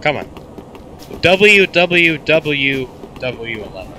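usual speaking voice

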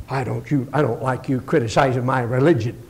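low, sulky objection; mock an offended churchgoer under the voice; grumbling with attitude. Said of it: "somebody with an attitude".

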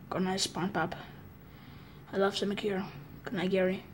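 drowsy murmur to SpongeBob; sleepy fading goodnight. Pretend. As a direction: drift off as you say it. Falling asleep.

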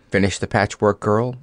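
ordinary delivery

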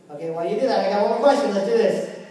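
loud, projecting to the room; calling out. Real, not acted. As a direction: call across the crowded room.

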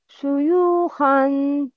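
شيوخا slow with a full tanween an at the end; slow model word. Shown as a demonstration. Teacher models tanween word to repeat, slow and careful. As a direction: stretch شيوخا for the pupils. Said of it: "Please listen and repeat".

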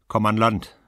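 loud call across the water. Acted. Woman calls to the man on the boat, loud. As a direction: shout to him in the boat. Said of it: "rief sie".

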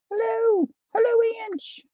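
very high, held sing-song hello; playful high greeting. Pretend. Belated hello to a viewer, playful high voice. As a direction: squeak out a cutesy greeting.

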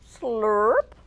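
loud slurp; slurping sound. A narrator's reading. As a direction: slurp it up loudly. Spaghetti sound word.

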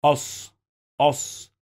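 أص said "ass", no l, straight into a held s; silent lam. Shown as a demonstration. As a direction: drop the lam and hold the saad in أص. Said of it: "We don't pronounce this lam".

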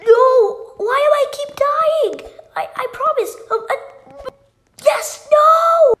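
loud, high frustrated cry; frustrated shout. Real, not acted. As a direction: yell it out in dismay. Keeps dying in game, frustrated.

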